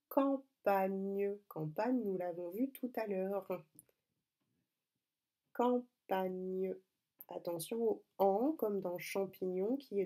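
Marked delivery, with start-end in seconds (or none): 0.1-1.4 campagne drawn out in syllables; slow dictation. Shown as a demonstration. 5.5-6.7 campagne drawn out in syllables; slow dictation. Shown as a demonstration. Dictating the word once more.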